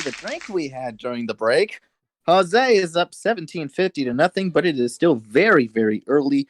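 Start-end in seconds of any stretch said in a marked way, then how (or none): none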